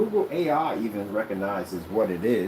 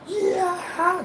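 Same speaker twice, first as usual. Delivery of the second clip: triumphant yell. Acted. Imitates screen bursting out, triumphant yell.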